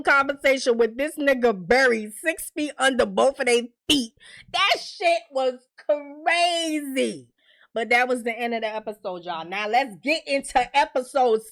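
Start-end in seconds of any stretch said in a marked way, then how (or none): 4.5-7.3 slow amazed. Real, not acted. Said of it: "drawn-out verdict".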